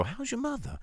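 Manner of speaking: lightly voiced high tough-guy greeting; a quick high, soft mock Wahlberg line; high, soft put-on quote; Mark Wahlberg impression. Quoting Mark Wahlberg in the film.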